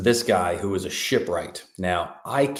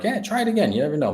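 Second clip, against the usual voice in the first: much higher-pitched voice for her; imitating her; 9 semitones higher, faster than usual. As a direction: put on a high voice, coaxing him to retry. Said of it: "but she's like".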